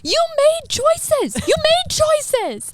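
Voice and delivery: high, loud, slow wail of blame; hammed-up high-pitched scolding of the victim; mock self-justifying outburst. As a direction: draw out each word in exaggerated protest. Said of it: "sometimes I'm like".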